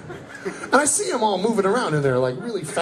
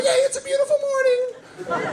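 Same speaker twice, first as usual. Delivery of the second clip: mock cheery greeting; much faster than usual. Comic mocking Fort Collins cyclists, high cheery shout. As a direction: gush at the morning like a blissed-out cyclist.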